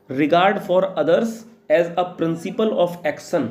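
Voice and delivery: each word spaced out slowly for students to write; a teacher reading a definition aloud to be copied down, slowly; slow dictation. Shown as a demonstration.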